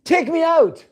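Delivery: high, loud call up the well; high mock shout. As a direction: yell for rescue from below. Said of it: "you shout up".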